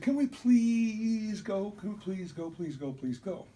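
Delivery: put-on high, slow, loud childish pleading that fades; whiny begging kids. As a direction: drag out the plea like an impatient child. Kids begging to leave church.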